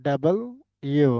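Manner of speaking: ডাবল rising, pause, then ইউ; correct W name. Shown as a demonstration. Teacher drilling the correction, ডাবল ইউ in two parts. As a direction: lift ডাবল, then place ইউ separately. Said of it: "কি বলতে হবে?".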